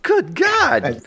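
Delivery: a child's high mock exclamation of disbelief; high exasperated kid. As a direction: gasp it like a startled little kid. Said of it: "I was like".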